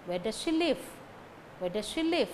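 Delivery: "she" unstressed, weight on "live"; weak "she". Shown as a demonstration. Teacher demonstrating weak pronouns, "she" unstressed. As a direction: stress the main words, pass over "she". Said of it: "give stress only to the main words".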